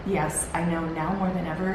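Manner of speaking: usual speaking voice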